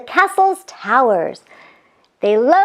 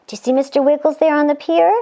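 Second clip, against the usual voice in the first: ordinary delivery, nothing marked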